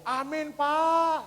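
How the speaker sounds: high, drawn-out mock cry; imitated woman's shout. Church woman answering the pastor, imitated long shout. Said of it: "Satu ibu-ibu teriak".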